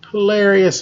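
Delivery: usual speaking voice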